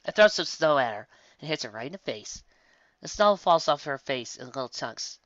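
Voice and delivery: ordinary delivery, nothing marked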